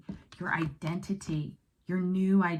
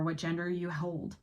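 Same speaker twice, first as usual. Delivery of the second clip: usual speaking voice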